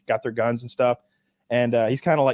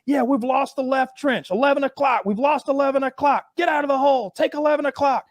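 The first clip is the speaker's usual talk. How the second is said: high, urgent radio call, shout at the end; mock soldier calling command; radio report; 11 semitones higher than usual. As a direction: bark orders over the radio, imitated. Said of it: "he's on the radio with command".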